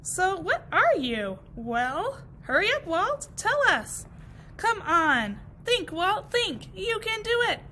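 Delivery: high, loud hurry-up calls; impatient urging. Acted. Grasses urging Walt to tell them, high and loud. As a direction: push him to answer fast.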